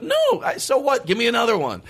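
mock indignant whine. Retold indignant demand for water.

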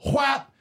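high-pitched little "whap" yelp; imitated high yelp. Little girl falling on her face, imitated yelp. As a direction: yelp it far above your voice. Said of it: "her voice is "Whap!".

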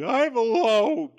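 mock sad. Chief sitting alone, mock sad.

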